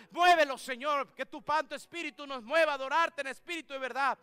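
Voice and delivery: loud, high pleading prayer; fervent prayer. Acted. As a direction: cry out to God over the people. Pastor prays over congregation. (oración ferviente en voz alta)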